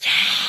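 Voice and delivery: loud growled yeah. Shown as a demonstration. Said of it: "for instance".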